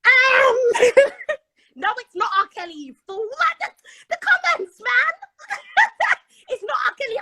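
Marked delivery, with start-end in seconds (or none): 0.1-1.8 loud high laughing outburst; shrieking laugh. Real, not acted. Guest bursts out at wrong guess. 3.3-7.2 high, loud indignant denial; appalled laughing denial; outraged surprise. Real, not acted. Indignant at [name] guess.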